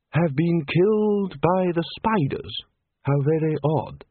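high, slow, prim bookish voice; fussy scholar. A narrator's reading. Chair reading a snake footnote to colleagues, high and slow. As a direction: read it out high and drawn out.

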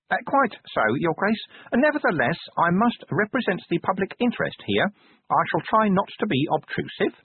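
timid but insistent official; prim inspector. A narrator's reading. Inspector explaining his inquiry to the Duke, prim and fussy.

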